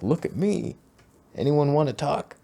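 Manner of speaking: attention seeker. Retold or imitated. Mocking a show-off in a cowboy photo, high needy voice. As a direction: mimic a guy begging to be noticed. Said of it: "But this guy's like".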